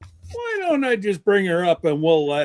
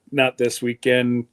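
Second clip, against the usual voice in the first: normal talking voice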